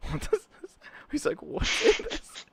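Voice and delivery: mock squeaky question; puzzled. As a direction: squeak it out in disbelief. Musician puzzled, retold.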